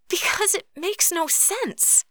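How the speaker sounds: very high, drawn-out exasperated protest; exasperated disbelief. A narrator's reading. Katori refusing to believe magic exists, exasperated. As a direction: protest slowly in a strained high pitch.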